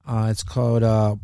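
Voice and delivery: ordinary talk, nothing marked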